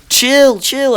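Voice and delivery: high-pitched retold plea; urgent calming. Retells calming the chasing friend.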